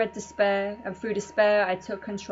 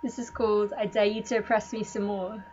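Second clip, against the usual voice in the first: ordinary talk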